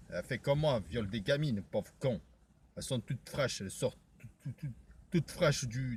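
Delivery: low, hushed, menacing voice; low character voice. Acted. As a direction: keep it down low and quiet.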